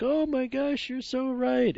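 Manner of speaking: high, drawn-out gushing quote; mock amazed agreement. Tablemates realizing the wine tastes cheap, mock amazed agreement.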